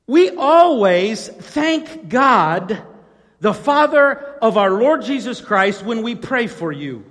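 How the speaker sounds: preacher reading Paul's thanks to Colossae, in full voice; preaching the scripture. Acted. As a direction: proclaim the verse to the congregation. Preaching Paul's letter aloud.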